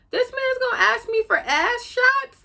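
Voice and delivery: very high-pitched incredulous outburst; indignant disbelief. Real, not acted. Telling viewers what her date asked for, indignant disbelief. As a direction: squeal it, scandalised. Indignant at his request for photos.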